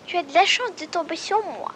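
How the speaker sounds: high, slow doe voice; a proud doe, drawn out and lifted; doe's voice. Acted. The doe answers the squirrel, high and slow. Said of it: "La biche répondit".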